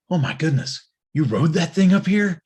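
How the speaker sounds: put-on high, excited amazement from a young rider; amazed kid. Young rider gasping at the bike, high and amazed. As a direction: gush in disbelief at the bike.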